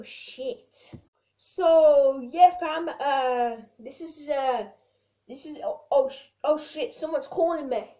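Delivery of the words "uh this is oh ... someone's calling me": normal talking voice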